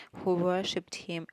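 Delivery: usual speaking voice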